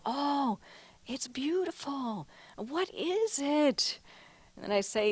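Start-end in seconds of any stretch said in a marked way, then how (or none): 0.0-4.0 admiring viewers. Retold or imitated. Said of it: "where people go".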